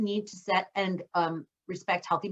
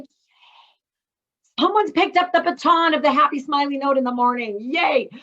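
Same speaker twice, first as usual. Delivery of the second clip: louder, higher tired-mom celebration; mock yay; 4 semitones higher, 6 dB louder than usual. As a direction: cheer like someone took the baton. Tired mom celebrating daughter's note.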